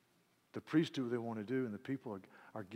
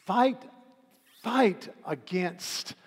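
emphatic fight; 7 semitones higher, 11 dB louder, much slower than usual. Acted.

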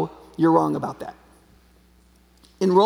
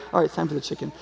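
ordinary talk, nothing marked

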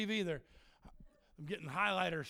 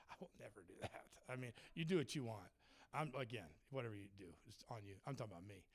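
sincere confession; 8 semitones lower, 12 dB quieter, faster than usual. Real, not acted. Sincere personal testimony.

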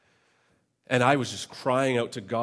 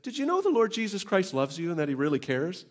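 higher, cheerful passing remark; friendly cyclist; 7 semitones higher than usual. Retold or imitated. Middle-aged man on a bike telling him Jesus cares. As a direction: call it out brightly while riding by. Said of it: "looks over at me and says".

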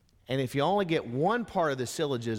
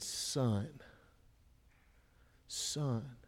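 tender; 2 semitones lower, 9 dB quieter than usual. Acted. Jesus tenderly addresses the paralyzed man.

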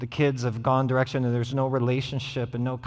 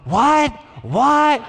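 put-on high, loud little-boy "what"; retold kid yell; 14 semitones higher, 11 dB louder than usual. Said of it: "It's like".

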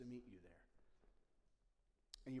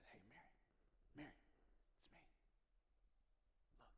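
much softer, hushed call; gentle, tender; 19 dB quieter than usual. Retold or imitated. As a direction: call her name softly, reassuring. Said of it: "he just said".

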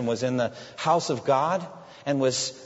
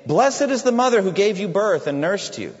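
a touch higher and louder, called from the crowd; calling out; 5 semitones higher, 5 dB louder than usual. Retold or imitated. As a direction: call out from the crowd. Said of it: "a woman in the crowd called out".

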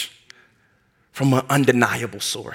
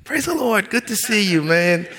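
much higher, over-bright phone greeting; reluctant fake cheer; 6 semitones higher, slower than usual. Answering a church guy's call, mock cheerful and reluctant. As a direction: answer the call with forced cheer, not wanting to talk. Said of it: "kinda a little bit reluctant".

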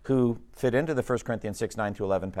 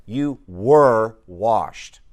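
"were" drawn out slow and loud; slow, weighty "You were washed"; stress on the past tense; 8 dB louder, much slower than usual. Shown as a demonstration. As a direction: lean hard on "were", very slowly. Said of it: "notice the past tense".

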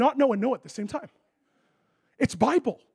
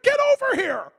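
much higher and louder, panicked; alarmed shout; 8 dB louder than usual. Retold or imitated. Medical staff yelling at the injured player skating, panicked. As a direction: yell them back off the ice.

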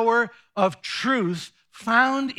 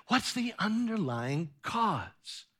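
quiet slow question; 5 dB quieter than usual. Acted. Soft slow repeat of question.

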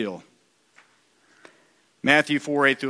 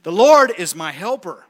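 louder, falling on Lord; proclaiming; 6 dB louder than usual. Acted. Preacher to congregation, bold proclamation. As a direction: declare it boldly.

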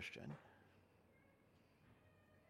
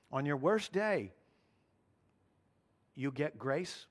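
ordinary talk

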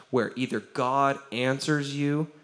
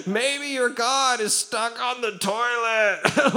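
high, slow, hammed-up taunt; making fun; 9 semitones higher, slower than usual. Elijah making fun of the prophets' god, hammed-up taunt. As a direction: draw it out in a silly high put-on voice. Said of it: "he's just totally making fun of him".

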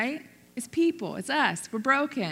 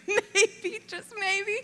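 higher, laughing through the words; laughing maybe; 10 semitones higher, much slower than usual. Real, not acted. Telling the congregation her realization, laughing high. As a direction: giggle out the maybe.

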